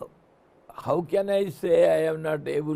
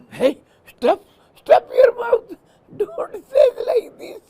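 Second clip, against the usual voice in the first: high, louder hushing elder; mock scolding; 7 dB louder than usual. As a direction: shush the children sharply.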